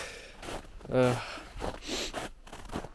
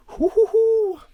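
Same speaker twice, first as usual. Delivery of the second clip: high hooting whoop; 10 dB louder, slower than usual. Real, not acted. Celebrating the salmon.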